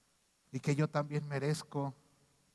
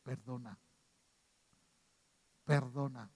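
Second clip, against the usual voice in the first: soft whisper of God; 3 semitones lower, 5 dB quieter than usual. Retold or imitated. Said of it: "escuchar su voz que me dice".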